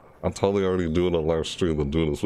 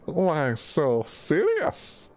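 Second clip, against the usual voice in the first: slow, higher, put-on villain voice; Joker voice; 8 semitones higher, much slower than usual. Shown as a demonstration. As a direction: sneer it like the Joker. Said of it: "serious spray".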